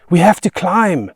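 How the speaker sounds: high, loud cry of alarm; urgent shout. A narrator's reading. Child trapped by the beast, shouting urgently. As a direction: shout it to get them moving.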